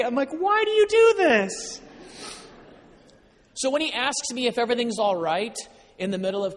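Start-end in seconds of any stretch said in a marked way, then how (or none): 0.3-1.8 very high, loud, drawn-out wail; mock crying wail. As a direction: wail it slowly like an overwrought dad. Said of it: "I started crying in the car".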